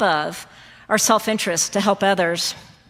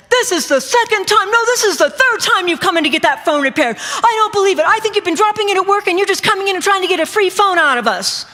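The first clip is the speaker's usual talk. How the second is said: loud, high-pitched accusing rant; angry accusation; 11 semitones higher, 4 dB louder than usual. Retold or imitated. Store clerk accusing a customer of dropping his phone, angry and loud. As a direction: berate the customer in a raised, indignant voice.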